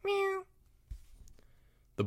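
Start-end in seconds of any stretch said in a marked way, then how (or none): 0.0-0.5 tiny, very high kitten voice; soft meow. Acted. Kitten answering its owner's cries, soft meow. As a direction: mew softly like a kitten. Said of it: "a soft".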